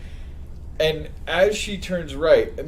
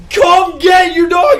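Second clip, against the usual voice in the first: high, loud, drawn-out retold shout; acted-out screaming match; screaming back; 13 semitones higher, 10 dB louder, slower than usual. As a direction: bellow it slowly at the dog's owner. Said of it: "We're just screaming at each other".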